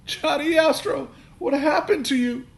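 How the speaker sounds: very high, loud anguished cry; wailing. A narrator's reading. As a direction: cry out over the broken toy. Dad grieving broken toy.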